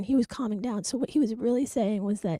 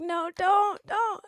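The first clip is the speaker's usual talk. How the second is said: put-on pleading kid; 9 semitones higher, much slower than usual. Son refusing the wipe.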